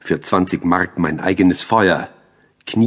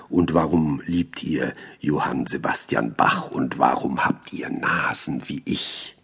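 usual speaking voice

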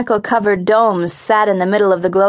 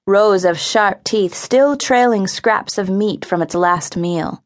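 usual speaking voice

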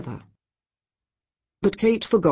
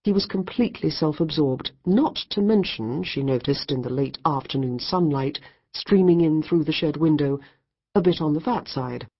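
plain reading voice

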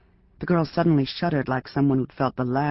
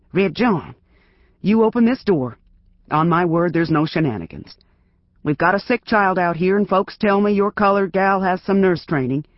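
loud, higher, pressing plea through the door; a nervous lawman urging, louder; nervous, winded sheriff; 4 semitones higher, 5 dB louder than usual. Acted. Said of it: "sounded unusually nervous and winded".

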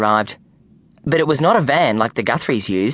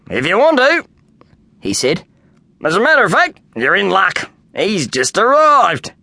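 higher, louder cheerful workman; confident Kenny; 5 semitones higher, 3 dB louder than usual. A narrator's reading. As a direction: insist brightly he has it right. Kenny voiced in story.

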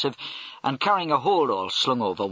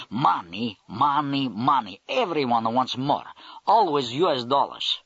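put-on Russian accent; slower than usual. Russian major explains mafia motive.

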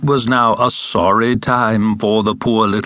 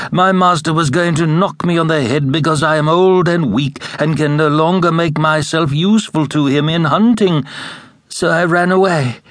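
lightly higher, plaintive voice; a hint of a tired old hound; weary old dog; 7 semitones higher than usual. A narrator's reading. Old dog telling the ass his woes, mildly plaintive.

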